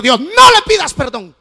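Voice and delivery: loud, high rebuke, then slow; daughter scolding. Retold or imitated. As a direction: scold him like the hurt daughter. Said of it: "Y me dijo".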